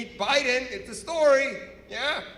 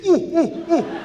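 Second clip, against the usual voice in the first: loud, high-pitched "ooh" bursts; Persian alarm hoot; 10 semitones higher, 9 dB louder, slower than usual. Shown as a demonstration. Explaining what Persians say when trouble comes, alarmed hooting. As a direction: demonstrate a hooted warning that trouble is coming. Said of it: "When something bad happens for Persians".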